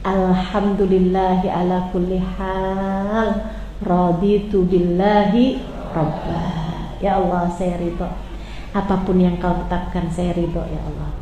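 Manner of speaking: surrender gently to God's will; quiet surrender. Acted. Prophet's accepting prayer voiced.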